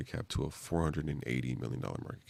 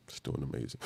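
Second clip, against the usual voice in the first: talking through hiccups; words broken by hiccups; 2 semitones lower, 4 dB quieter, faster than usual. Real, not acted. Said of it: "I got the hiccups".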